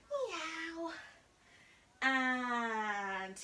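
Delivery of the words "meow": higher playful meow; teacher meowing during cat sit-ups. Pretend. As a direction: mew like a little cat. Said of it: "our cat sit-ups".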